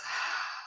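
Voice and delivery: soft breathy sigh out; relaxing sigh. Shown as a demonstration. Meditation teacher showing how to relax, soft sigh. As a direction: let go with a quiet exhale.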